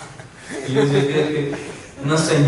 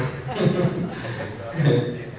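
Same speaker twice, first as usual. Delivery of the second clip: soft real chuckle; laughter; 3 semitones lower, 4 dB quieter than usual. Real, not acted. Preacher told to concentrate, laughing softly. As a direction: chuckle quietly at the interruption.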